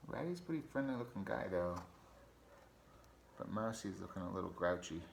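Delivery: ordinary delivery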